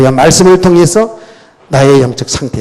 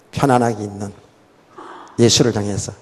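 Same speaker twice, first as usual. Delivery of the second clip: ordinary talk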